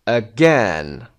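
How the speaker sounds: again with GAIN punched and falling; light start, strong second syllable in again; stress on second syllable. Shown as a demonstration. Teacher working through the exercise, second-syllable stress on again. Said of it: "second syllable".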